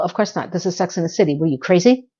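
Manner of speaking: ordinary delivery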